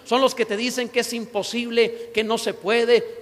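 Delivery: ordinary talk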